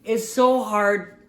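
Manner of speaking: slow, choked-up emotional words; held back tears, drawn out; real emotion catching in the throat; getting emotional. Real, not acted. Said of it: "I'm getting emotional just thinking about it".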